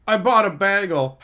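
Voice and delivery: a hint of a goofy sitcom character; lightly voiced sitcom nerd; mock sitcom line. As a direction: deliver it like a punchline that isn't one. Mocking sitcom dialogue.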